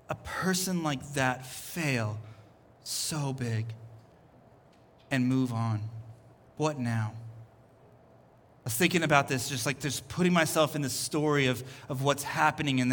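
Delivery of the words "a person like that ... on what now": low, soft, spaced-out question; real hushed pondering. Real, not acted. Preacher asking congregation after Peter's failure, quietly grave. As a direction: let each phrase sink in quietly.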